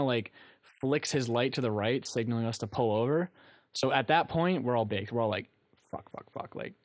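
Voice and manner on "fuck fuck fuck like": retold whispered swearing; panicked whisper. Stoned friends at a police stop, whispering in panic. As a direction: mutter it under your breath, voiceless.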